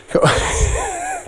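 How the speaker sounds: high-pitched amused laugh. Real, not acted. Preacher after the baby-name joke, high laugh.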